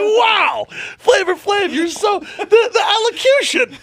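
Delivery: high squeal through laughter; laughing mock awe. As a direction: gush while cracking up.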